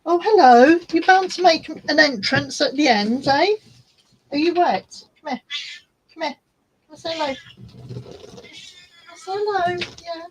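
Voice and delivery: high cooing voice to the cat; high sing-song voice for a pet. Real, not acted. Talking baby talk to her cat.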